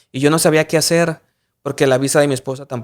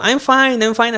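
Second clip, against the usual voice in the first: chirpy clueless reply; 8 semitones higher, slower than usual. Retold or imitated. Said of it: "Y yo".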